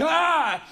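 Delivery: loud, high scream of alarm; horrified scream. Retold or imitated. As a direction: recoil with a full scream. Guy screams in response.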